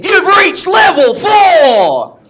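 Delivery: loud, high, slow game announcer; video game announcer. Retold or imitated. Video game voice announcing the level, loud and slow. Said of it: "sounds like a video game".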